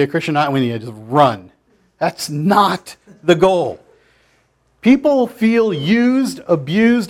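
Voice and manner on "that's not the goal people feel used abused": slow emphatic. Acted. Preacher slows for emphasis.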